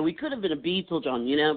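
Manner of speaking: ordinary delivery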